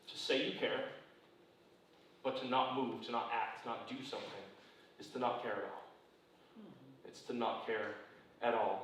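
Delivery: low, hushed sermon delivery; solemn preaching. Real, not acted. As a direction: drop low and quiet to drive the point home gravely. Preacher drops into solemn emphasis.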